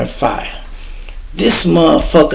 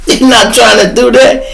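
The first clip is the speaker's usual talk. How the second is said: laughing while talking; 6 semitones higher than usual. Retold or imitated. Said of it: "I started laughing so hard".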